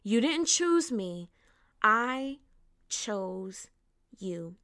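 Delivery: "I", "chose", "you" each set apart by long pauses; emphatic repetition. Shown as a demonstration. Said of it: "I want to emphasize on the very first two parts of that".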